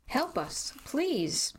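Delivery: a high, pleading cry for help; high-pitched desperate "please". Acted. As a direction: beg for help in panic. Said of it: "I screamed at Ricky to call for help".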